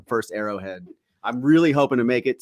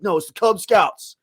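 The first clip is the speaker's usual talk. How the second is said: raised pitch, firm and serious; stern but restrained; 9 semitones higher, 4 dB louder than usual. Retold or imitated. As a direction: say it seriously, holding back the insult. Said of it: "But he was like".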